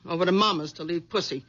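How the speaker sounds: low, nagging housewife voice; low, bossy nagging wife. Acted. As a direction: scold him like a domineering wife.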